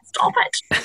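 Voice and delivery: whispered self-reproach; hushed, voiceless telling-off of yourself; inner voice scolding. Retold or imitated. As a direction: whisper it like your own inner warning. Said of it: "that internal voice is going".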